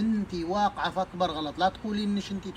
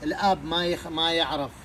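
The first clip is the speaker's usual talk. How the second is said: normal talking voice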